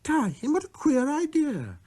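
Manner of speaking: put-on high, startled child's voice; shocked. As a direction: recoil at the very idea. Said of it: "seemed shocked by this offer".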